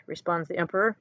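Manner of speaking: ordinary delivery, nothing marked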